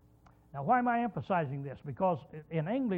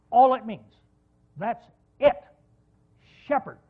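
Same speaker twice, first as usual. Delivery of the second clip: emphatic near-shout; 4 semitones higher, 11 dB louder than usual. Real, not acted. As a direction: punch it out loud and higher. Preacher driving his point home.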